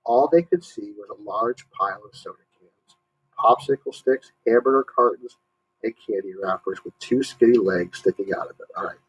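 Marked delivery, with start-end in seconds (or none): none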